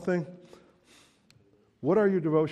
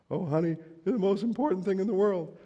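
mock sweet talk; 9 semitones higher, 5 dB louder than usual. Husband's empty sweet talk.

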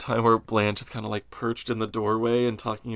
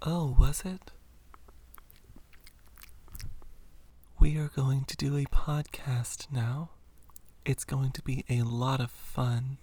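ASMR voice; 3 semitones higher, 5 dB quieter than usual. Pretend. Keeps the ASMR voice going.

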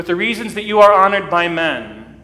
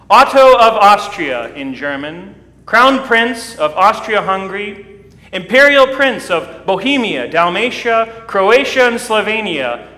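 loud, higher proclamation of a list of titles; herald yelling titles; 3 semitones higher, 6 dB louder than usual. Retold or imitated. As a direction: announce each title like a herald at the gate. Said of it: "yelled through the door in response".

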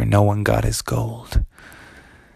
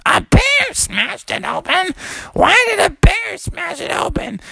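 very high, incredulous shrieking; mother echoing in shrill disbelief; shocked mommy frog. Acted. Mom questions their bear excuse, shocked shriek.

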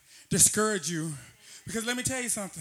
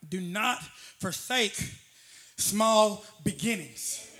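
slow emphasis; 7 semitones lower, much slower than usual. Real, not acted.

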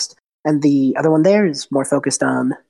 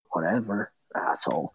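much lower, softer grumble; sullen brute; 8 semitones lower, 10 dB quieter than usual. Acted. The big minion grumbling back at the hag. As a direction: mumble it low and sulky. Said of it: "this big one just goes".

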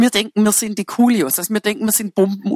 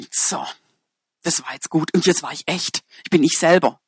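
softer, lower mock contentment; self-satisfied relief; 6 semitones lower, 4 dB quieter than usual. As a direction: settle down smugly, as if job done. Acts self-satisfied person after outburst. (gespielt zufrieden, leise und tief)

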